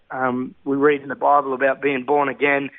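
ordinary talk, nothing marked

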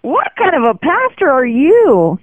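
shocked man protesting to the pastor; outraged disbelief. Retold or imitated. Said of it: "Tim said".

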